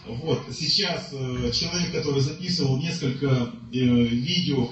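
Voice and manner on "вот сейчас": ordinary talk, nothing marked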